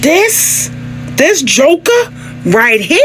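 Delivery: high, drawn-out sassy reaction; mock sassy viewer. Wife and husband react to the show, sassy and high. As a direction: camp it up like a shocked viewer. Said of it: "every episode we were like".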